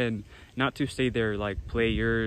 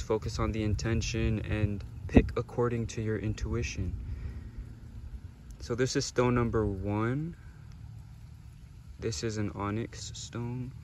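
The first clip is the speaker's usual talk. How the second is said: soft guided meditation; 2 semitones lower, 9 dB quieter than usual. Acted. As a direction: gently guide them through the choice. Soft stone-selection meditation segment.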